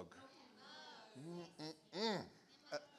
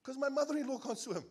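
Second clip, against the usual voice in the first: high, louder punchline; 9 semitones higher, 7 dB louder than usual. Retold or imitated. Smiling guy at the Red Sea answers, gleeful. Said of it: "He replies".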